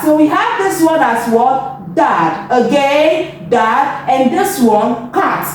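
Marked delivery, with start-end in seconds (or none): none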